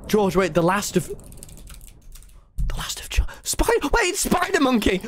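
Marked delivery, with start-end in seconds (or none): 1.1-3.3 whisper. Real, not acted. Unvoiced trailing whisper. 3.4-5.0 high alarmed call; urgent, pitched way up. Real, not acted. Alarmed game moment.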